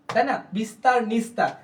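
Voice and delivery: ordinary talk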